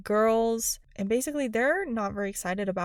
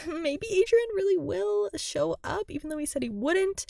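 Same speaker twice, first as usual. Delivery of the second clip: hopeful daydreaming; dreamy Marinette; 9 semitones higher than usual. Retold or imitated. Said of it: "being like".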